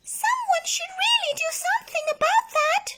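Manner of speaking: very high bird voice. A narrator's reading. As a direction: chirp it indignantly. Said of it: "said the bird".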